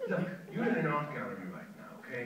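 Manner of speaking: ordinary delivery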